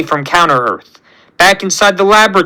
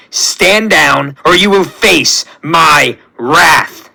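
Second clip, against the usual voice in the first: furious villain; 4 semitones higher, slower than usual. Acted. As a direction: bellow it with contempt. Villain raging at hero.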